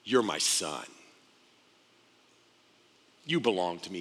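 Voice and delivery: low, soft fatherly assurance; quiet, intimate affirmation; tender father. Acted. God voiced speaking to his Son.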